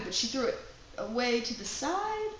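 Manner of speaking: usual speaking voice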